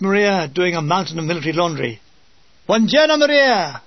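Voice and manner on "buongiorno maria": higher, louder cheerful hail; calling out a greeting. Acted. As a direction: sing out hello to the laundry girl. Greeting Maria across the slope.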